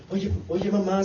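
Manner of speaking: ordinary talk, nothing marked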